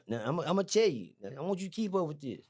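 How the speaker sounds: ordinary talk, nothing marked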